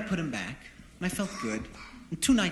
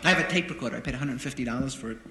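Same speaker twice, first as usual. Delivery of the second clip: normal talking voice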